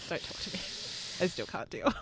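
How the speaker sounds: hushed pretend sulk; mock sulky. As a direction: sulk under your breath. Mock jealousy at friend.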